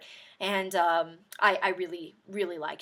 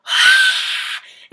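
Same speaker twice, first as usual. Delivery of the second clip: crowd gasp; 15 dB louder than usual. Retold or imitated. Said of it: "and they went".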